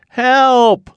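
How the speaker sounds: high, loud cry for help; yell for help. Acted. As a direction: call out, lost and scared. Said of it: "yelled Little Bear".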